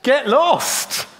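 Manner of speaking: playful put-on voice telling God to go; mock dismissal. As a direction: toss it away half-jokingly. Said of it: "he's telling God".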